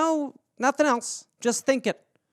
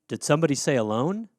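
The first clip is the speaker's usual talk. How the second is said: smooth ad pitch; 7 semitones lower, 8 dB quieter than usual. Acted. Company ad voice interrupts.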